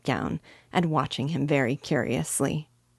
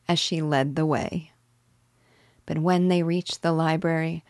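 ordinary delivery

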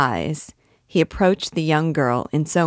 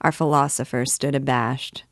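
plain reading voice

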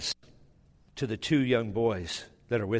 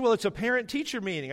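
higher-pitched imitation of the wife explaining; wife's insistence; 8 semitones higher, faster than usual. Wife reminds husband what the meeting is, higher insistent voice. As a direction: voice the wife higher, pointing out the obvious. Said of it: "She said".

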